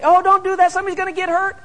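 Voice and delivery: high fretting mother's warning; worried mom voice. Retold or imitated. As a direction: squeak it out like an anxious mom. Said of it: "Moms would say".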